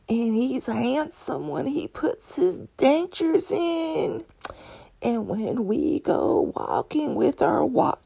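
slow, high Southern drawl, hammed up; gushing old Southern lady, drawn out and high; Southern accent. Future self bragging about finding the one, in a hammed-up Southern accent. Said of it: "I'm probably gonna have a Southern accent".

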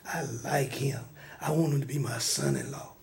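low, hushed whisper in the ear; whispered manipulative flattery; sneaky whisper. Acted. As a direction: murmur it slyly, very soft and low. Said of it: "start whispering in David's ear".

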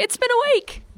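high-pitched radio host sign-off; imitating a chirpy Triple J presenter; mock Triple J DJ. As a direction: sing it out like a youth station DJ. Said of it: "Isn't that so Triple J?".

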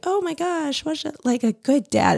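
high, airy mock gush; hammed-up breathless admiration; gushing praise. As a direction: gush over the dad like he is a hero. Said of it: "lauded as, like".

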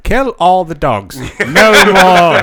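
high, pompous gentleman villain voice; mustache twirling villain. Pretend. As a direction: play a hammy posh villain. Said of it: "a guy twirling his moustache".

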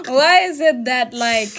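loud, slow, emphatic demand; exasperated self-questioning. Retold or imitated. Speaker challenging her own turn-off, exasperated. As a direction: press yourself hard for a reason.

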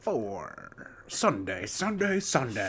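drawn-out promo parody; mock monster-rally radio ad; hammed-up event announcer. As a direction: play the arena hype man, stretching each word.

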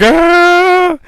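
high, sustained "aah"; long comic yell held on one high note; drawn-out mock cry. Host imitating Jason barely moving through space, drawn-out high cry. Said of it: "He's like".

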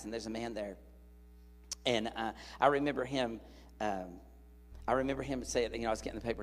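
usual speaking voice

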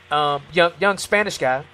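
normal talking voice

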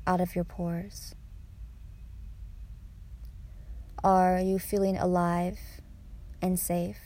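calm, measured meditation voice with lingering pauses; guided meditation. Acted. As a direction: ease them gently out of meditation.

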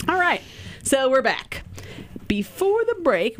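high giggly restart after laughing; giggle. Real, not acted. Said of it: "That's why we're giggling right now".

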